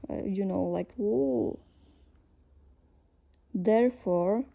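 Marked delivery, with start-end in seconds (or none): 1.0-1.6 L held and drawn out; sustained, stretched L sound; long L. Shown as a demonstration. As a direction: hold on to the L. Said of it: "is a long L".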